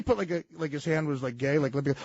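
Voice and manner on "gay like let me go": usual speaking voice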